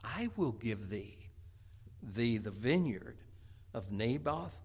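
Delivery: low, soft, slow sly promise; scheming Jezebel. Acted. Jezebel slyly promising Ahab the vineyard, soft and low. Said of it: "Jezebel's wife said".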